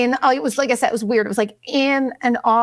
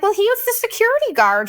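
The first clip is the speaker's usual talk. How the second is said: much higher, wide-eyed innocent excuse; imitating her own clueless excuse, much higher; mock innocent; 11 semitones higher than usual. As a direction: play dumb and naive. Her own naive excuse retold.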